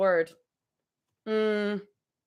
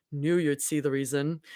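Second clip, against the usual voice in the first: Abby voice; 8 semitones lower, 7 dB quieter than usual. Acted. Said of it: "my Abby voice".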